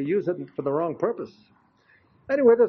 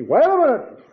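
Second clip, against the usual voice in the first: sharp, higher, louder retort; boy firing back a raised-voice objection; 9 semitones higher, 6 dB louder than usual. Retold or imitated. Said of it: "he raised his voice at me".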